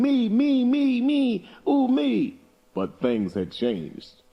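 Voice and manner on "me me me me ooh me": put-on very high squeaky children's clamor; children squabbling for the prize, high and pleading; clamoring kids. A narrator's reading. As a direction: squeal like greedy kids all wanting it. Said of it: "fighting and yelling".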